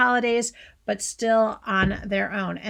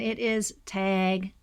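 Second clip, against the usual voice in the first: slow, drawn-out Midwest tag bag; Midwest accent; 3 semitones lower, much slower than usual. Shown as a demonstration. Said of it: "I got that Midwest accent coming out".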